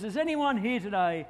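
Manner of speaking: preacher's call. Retold or imitated. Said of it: "and he says".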